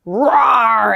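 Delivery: loud mock roar; hammed-up dragon roar; playful dragon roar. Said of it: "year of the dragon".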